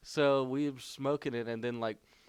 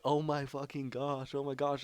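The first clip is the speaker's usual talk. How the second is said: higher-pitched mock amazement; acted-out oh-my-gosh moment; retold disbelief; 4 semitones higher than usual. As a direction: replay the stunned reaction, pitched up. Retold reaction after the cop leaves.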